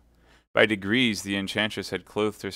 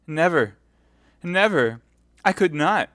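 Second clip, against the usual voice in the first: high, loud cries; anguished refusal; 9 semitones higher, 9 dB louder than usual. Acted. Milady refusing to confide in Felton, anguished cry. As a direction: cry out in distressed protest.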